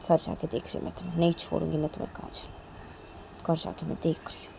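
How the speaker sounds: soft whispered threat; menacing whisper. Acted. Mother threatening daughter quietly, whispered and menacing. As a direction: hiss the threat under her breath. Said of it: "काजल की mummy बोलती".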